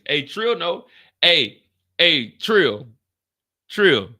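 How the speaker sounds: loud call for Trill. Real, not acted. Calling Trill over, a loud call. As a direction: call him over across the room.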